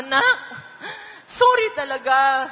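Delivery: lightly voiced crying plea to her child; a hint of tears, apologizing to her son; mild retold sob-plea; retold tearful apology. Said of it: "umiyak na ako".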